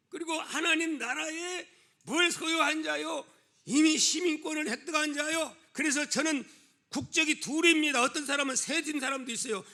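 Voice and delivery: high, emphatic sermon voice; full-force preaching. Real, not acted. Preacher proclaiming citizenship in God's kingdom, full-force preaching. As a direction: declare it forcefully to the congregation.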